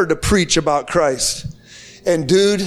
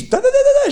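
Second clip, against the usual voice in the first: much higher, louder mock shriek of nonsense syllables; imitating a screaming mother; 4 dB louder, faster than usual. Preacher imitating the mother screaming at him, high mock shriek. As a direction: mimic her frantic cries in a high burst. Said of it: "She starts screaming at me".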